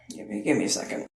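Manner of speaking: very low, near-whispered request; needing a moment. Real, not acted. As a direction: murmur it, pausing to collect yourself. Storyteller pauses, overcome mid story.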